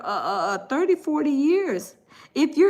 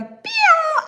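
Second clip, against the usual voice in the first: squeaky pew zoom effect; high zoom sound; 4 dB louder than usual. Retold or imitated. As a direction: make a quick zoom noise.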